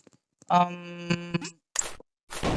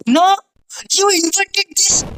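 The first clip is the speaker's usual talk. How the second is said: high, loud yell of protest; real exasperated shout, much higher; frustrated outcry; 12 semitones higher, 6 dB louder than usual. Real, not acted. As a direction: cry out at the game, pitch shooting up. Reacting to a game mishap.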